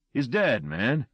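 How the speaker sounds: firm, pressing on DEAD; insisting. A narrator's reading. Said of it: "Luther insisted".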